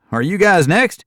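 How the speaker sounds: high, loud friendly question; cheery call. Acted. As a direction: call over brightly to the newcomers. Stranger calling out.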